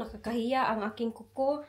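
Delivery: normal talking voice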